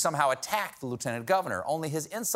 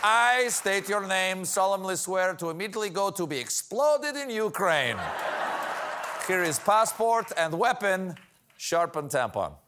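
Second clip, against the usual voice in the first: Russian official; 4 semitones higher than usual. Acted. Acted Russian oath official.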